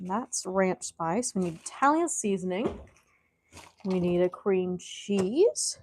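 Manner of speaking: slow, soft muttering while searching; muttering to herself. Real, not acted. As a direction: talk under your breath to yourself.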